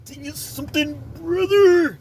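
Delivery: high wrestler voice, swelling on the last word; the Hulkster. Retold or imitated. Storyteller repeating how Big Will talked, high wrestler impression. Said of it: "That's how he talked".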